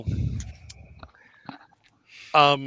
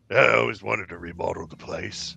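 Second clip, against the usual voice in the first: lightly voiced bartender, pitched lower; a lower in-character voice for the barkeep; 4 semitones lower than usual. Bartender tells visitors about the wreck, lightly in character. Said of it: "And he, and he's like".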